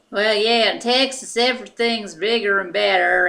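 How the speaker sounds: loud, hammed-up Texan boast; Texan accent. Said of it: "talking kind of more like this".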